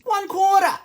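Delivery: high, loud call; calling out measures. Pretend. Calls out measures while pouring.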